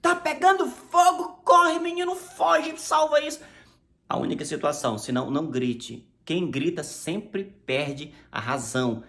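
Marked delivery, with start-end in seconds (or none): 0.0-3.4 high, loud panicked yell; fire alarm shout. Retold or imitated. Preacher acting out the one allowed yell: a loud fire alarm shout. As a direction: shout the house is burning, get out. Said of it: "Aí grite".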